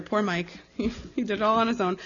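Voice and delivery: ordinary talk, nothing marked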